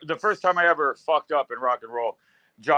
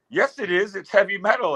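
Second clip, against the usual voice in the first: higher-pitched imitation of someone insisting; mock insistence; 5 semitones higher than usual. Bar dude argues with the doubters, mock-insistent.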